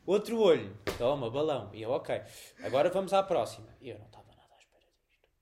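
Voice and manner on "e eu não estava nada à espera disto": fast, soft whisper; hushed confiding aside. Real, not acted. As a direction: slip it in quickly under your breath. Aside while retelling the exam.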